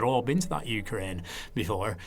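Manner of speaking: low, soft Lancashire impression; broad Lancashire storytelling voice; Lancashire accent. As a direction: imitate an older Lancashire man, low and quiet. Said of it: "So it's my Lancashire accent there".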